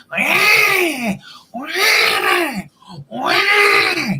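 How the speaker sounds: mock baby crying wah, high and loud; high loud baby wails. Describing how some children cry, high loud mock wails. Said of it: "children that just".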